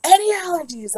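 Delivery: high, loud mock yell; retold yelling. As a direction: imitate staff yelling out a question. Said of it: "Like, they're yelling".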